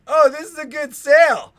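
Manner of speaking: thrilled at the start of the ride; giddy coaster rider. Pretend. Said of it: "we're like".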